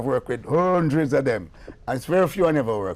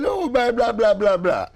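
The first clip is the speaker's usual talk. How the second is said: dismissive babble; 10 semitones higher, 6 dB louder than usual. Retold or imitated. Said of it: "I say".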